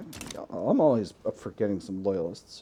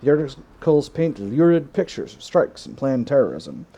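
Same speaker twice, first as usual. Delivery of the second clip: event read-out; 3 semitones higher, 6 dB louder than usual. Acted. Reading event text aloud.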